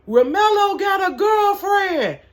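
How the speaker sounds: high, drawn-out gleeful holler. Retold or imitated. Older coworker teasing from the back of the store, hollering. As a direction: holler it out loud and slow. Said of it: "and she's hollering".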